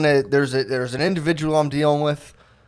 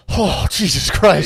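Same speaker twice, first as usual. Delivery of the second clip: slow, stretched out groan of dismay; 6 semitones higher, 5 dB louder, slower than usual. Retold or imitated. Reading comments, a slow dismayed groan. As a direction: drag it out like reading bad news. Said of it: "I read it and I'm like".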